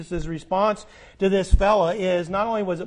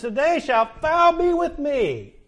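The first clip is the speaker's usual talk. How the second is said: higher, slow, solemn voice of Jesus; voicing Jesus; 8 semitones higher, 3 dB louder, slower than usual. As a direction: lift it up and slow down as the Lord's promise. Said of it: "And so he says".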